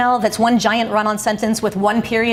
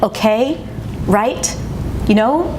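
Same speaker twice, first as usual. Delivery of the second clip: rising tune on "Okay", "right" and "you know", each ending up like a question; upspeak; slower than usual. Shown as a demonstration. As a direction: glide the pitch up on every tag word. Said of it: "little upward glides in pitch".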